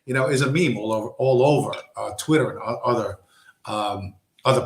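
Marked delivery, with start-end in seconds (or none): none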